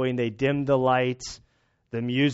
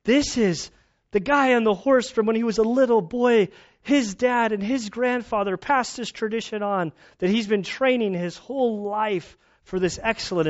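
higher, fervent defence of the rider; husband defending his tears to his wife, impassioned and raised; impassioned protest; 7 semitones higher than usual. Retold or imitated. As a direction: pitch up, insist the horses mean much more. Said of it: "I'm like".

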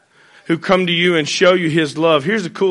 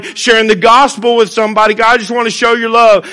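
earnest, raised prayer to God; spoken prayer; 5 semitones higher, 5 dB louder than usual. Acted. Pastor praying for a chance to show love, earnest prayer. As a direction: pray it out loud for the room.

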